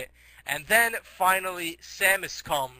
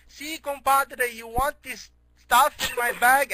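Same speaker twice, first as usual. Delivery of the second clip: high, slow, hammed-up Mexican accent; 6 semitones higher, 3 dB louder, slower than usual. Mexican guy offering stuff from his bag, exaggerated Mexican accent. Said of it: "can you stop with the accent?".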